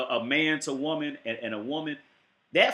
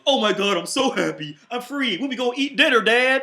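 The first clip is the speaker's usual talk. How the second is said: higher and louder, gushing with joy; a freed robot gushing to his father, high and loud; overjoyed robot; 8 semitones higher, 6 dB louder than usual. Retold or imitated. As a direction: burst out like a giddy kid. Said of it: "Robert Williams goes".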